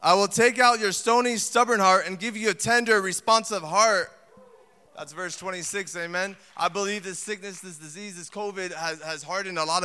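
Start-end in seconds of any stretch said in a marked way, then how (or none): 0.0-4.1 loud, ringing preacher's read; forceful scripture preaching. Acted. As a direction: proclaim the verse to the congregation.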